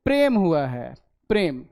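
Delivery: "प्रेम" with pr fused fast; correct prem. Shown as a demonstration. Teacher giving pupils the correct quick prem. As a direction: snap the pr of "प्रेम" together quickly. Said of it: "एक, दो और ये आधा".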